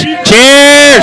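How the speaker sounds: loud high cheer; cheering toast. Real, not acted. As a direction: raise the glass and shout it. Toast at celebration party.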